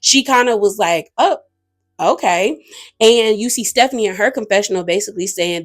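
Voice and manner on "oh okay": imitated mild surprise, a startled little oh then okay; taken aback. Retelling a cast member's reaction to criticism, taken aback. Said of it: "was kinda taken aback by it".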